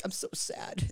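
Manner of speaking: breathy, whispered mopey emo voice; mock sad whisper. Teasing friend imitating heartbroken Chris, whispered and mopey. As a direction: sigh it out like a heartbroken emo kid. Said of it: "You're just like".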